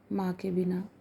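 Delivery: ordinary delivery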